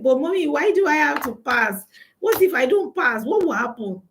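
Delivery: a hint of a curious five-year-old; retold child. Five-year-old daughter questioning her mother about exams, lightly childlike. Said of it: "the girl said".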